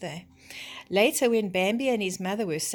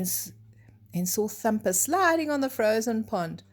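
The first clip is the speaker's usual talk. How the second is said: usual speaking voice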